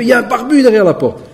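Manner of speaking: voix du voisin, plus aiguë, criée en riant; cri imité. Retold or imitated. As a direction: imiter le voisin qui crie à sa femme. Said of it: "Wallah, il crie". (le voisin prévient sa femme derrière la porte, cri imité plus aigu)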